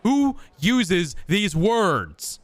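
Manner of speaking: exasperated disbelief. Real, not acted. Streamer mocking the game's old-fashioned wording, loud and incredulous. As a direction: stretch it out, baffled by the stiff dialogue.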